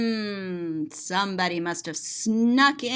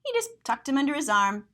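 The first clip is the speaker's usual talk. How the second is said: ordinary delivery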